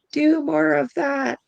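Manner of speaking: a spirit urging the speaker on, pushing harder with each repeat; spirit's urging. Retold or imitated. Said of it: "spirit was going".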